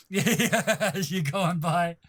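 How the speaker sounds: ordinary talk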